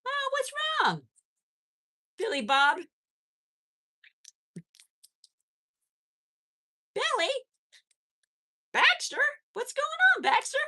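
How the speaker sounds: very high, loud sing-song calling of names; calling out to the bunnies. Real, not acted. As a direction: call the pets from across the room, high and bright. Calling out to pet rabbits.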